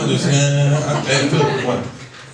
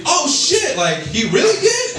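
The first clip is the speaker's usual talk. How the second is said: excited disbelief; 14 semitones higher, slower than usual. Retold or imitated. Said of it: "I'm like".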